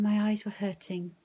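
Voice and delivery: usual speaking voice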